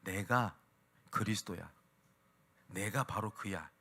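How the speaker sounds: low, soft and slow, kindly revealing himself; gentle reassurance. Retold or imitated. Jesus gently answering the Samaritan woman, soft and tender. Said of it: "인격적으로 대답하시면서".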